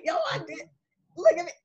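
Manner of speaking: high, bright-eyed boast to yourself; a touch of excited self-congratulation; proud self-praise. Retold or imitated. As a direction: crow about standing up for yourself. Proud after standing up for yourself.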